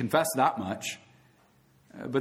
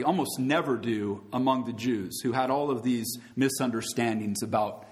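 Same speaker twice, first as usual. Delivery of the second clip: ordinary talk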